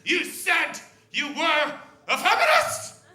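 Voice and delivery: high, loud, slow incredulous yell; enraged woman. Retold or imitated. Angry older woman confronting the comic, a shrill yell. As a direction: hit him with outrage. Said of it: "in a rage".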